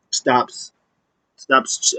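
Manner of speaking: ordinary delivery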